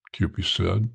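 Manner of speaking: usual speaking voice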